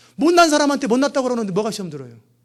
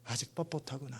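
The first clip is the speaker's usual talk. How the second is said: soft repeat; 8 semitones lower, 19 dB quieter than usual. Retold or imitated. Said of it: "그러는 거예요".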